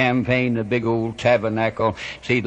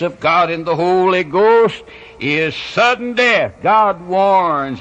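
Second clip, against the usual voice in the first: higher, mounting pulpit proclamation; fervent preaching; 5 semitones higher than usual. Real, not acted. Preacher warning of sudden death, fervent preaching. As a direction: preach it with rising force to a ringing warning.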